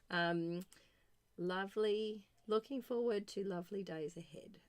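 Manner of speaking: usual speaking voice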